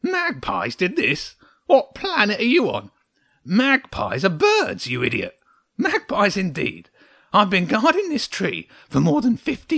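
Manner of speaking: high, loud, derisive little creature; boastful, exasperated hedgehog; grumpy hedgehog, scornful. A narrator's reading. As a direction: scoff indignantly, drawing out key words.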